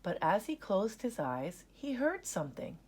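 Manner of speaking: ordinary delivery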